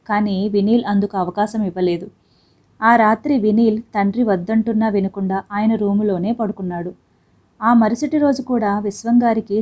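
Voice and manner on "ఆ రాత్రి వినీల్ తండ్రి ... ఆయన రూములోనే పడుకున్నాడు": usual speaking voice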